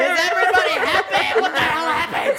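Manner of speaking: startled outburst. Acted. Host walks into crying room.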